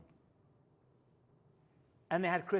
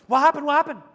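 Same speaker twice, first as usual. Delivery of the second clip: startled; 7 semitones higher, 13 dB louder, faster than usual. Retold or imitated. Woken man startled.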